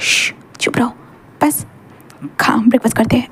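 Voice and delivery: husband whispering softly to hush Wing. Acted. Husband hushing Wing at breakfast, whispering.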